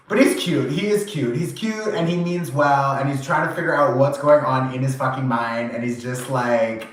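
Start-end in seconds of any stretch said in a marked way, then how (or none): none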